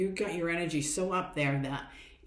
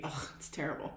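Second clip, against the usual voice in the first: quiet, softer groaning reply; mock glum client; 6 dB quieter than usual. Client answering the coach about energy, mock deflated and softer. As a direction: sink into a deflated mock complaint. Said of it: "How's your energy?".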